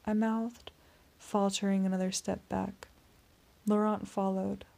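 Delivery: ordinary delivery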